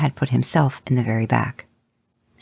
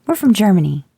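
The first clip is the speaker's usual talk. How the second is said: a lightly voiced girl, higher and louder with pride; proud little girl; 8 semitones higher, 5 dB louder than usual. Daughter proudly telling the brothers, lightly voiced little girl. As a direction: boast it a little, like a proud kid. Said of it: "explained with a proud grin".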